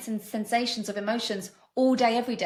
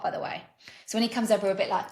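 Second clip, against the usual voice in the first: normal talking voice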